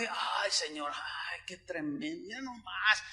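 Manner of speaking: softly, in awe of the sunrise; awed murmur. Retold or imitated. Preacher recalls gazing at the sunrise, awed and hushed. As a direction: breathe it out, amazed and hushed. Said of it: "me quedé parado".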